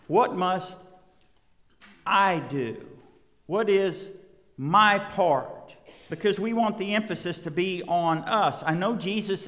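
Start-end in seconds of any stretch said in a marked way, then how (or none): 0.1-2.9 What must I do, leaning hard on I; stress on I. Shown as a demonstration. Preacher showing where people put the stress, on I. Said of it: "the emphasis is on I". 3.5-5.8 What is my part, punching my; slow down and land on my; stress on my. Shown as a demonstration. Preacher voicing the believer's question, stressing my. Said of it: "the emphasis is on".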